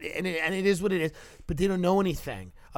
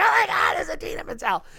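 much higher, squealing mock excitement; gushing fan; 13 semitones higher than usual. Theater kid starstruck on the tour, high squeal.

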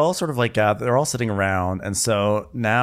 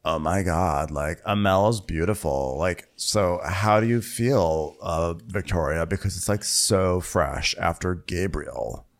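Margot; 5 semitones lower, slower than usual. Acted. Asking Victoria about the breakup, low languid mock voice. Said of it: "so Margot is, she's like".

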